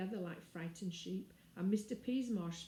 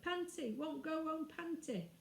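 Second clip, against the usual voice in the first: put-on high babbling toddler; a little one chirping a name; baby voice; 9 semitones higher than usual.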